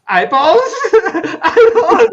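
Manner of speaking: high, loud, laughing imitation of horror; mock mortified cry. Mortified son sees the surprise dessert, mock horrified squeal. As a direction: squeal the question, half laughing.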